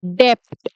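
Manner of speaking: डेप्ट said "dept", p sounded; wrong "debt" with p. Shown as a demonstration. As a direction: pronounce a p before the t in डेप्ट. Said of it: "बहुत सारे लोग इसको बोलते हैं".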